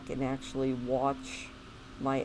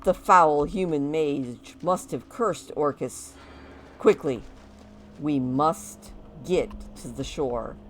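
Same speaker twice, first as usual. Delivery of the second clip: orc voice; 8 dB louder than usual. Acted. Voicing orc NPC Orcus.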